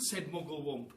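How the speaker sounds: ordinary delivery, nothing marked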